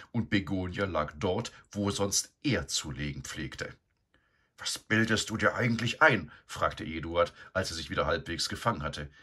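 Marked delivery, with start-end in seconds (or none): 4.6-6.3 loud, higher outraged question; indignant. A narrator's reading. Eduard confronting the sow in his stall, indignant and loud. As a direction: demand an explanation, indignant.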